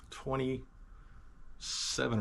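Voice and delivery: slow, soft, stretched-out musing; a very slow thinking-aloud drawl; drawn out. Real, not acted. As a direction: let the words trail out slowly. Recalling age, drawn out.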